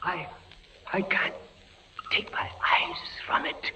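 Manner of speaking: soft fascinated whisper. Acted. Prisoner transfixed by the pendulum, soft whisper.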